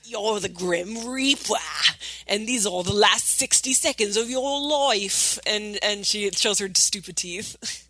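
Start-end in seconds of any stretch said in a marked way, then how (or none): 0.1-5.4 slow, menacing threat in bad Cockney-Australian; mock-dramatic Cockney-Australian villain. Crocodile villain threatening the Grim Reaper, mock-dramatic Cockney-Australian. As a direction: ham up the dodgy Cockney-Australian baddie. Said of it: "it's supposed to be all dramatic".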